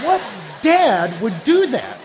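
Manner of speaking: slow, drawn-out disbelief at the question; slow incredulous challenge. Retold or imitated. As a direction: stretch it out, stunned that anyone would. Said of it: "I just wish I knew his tone".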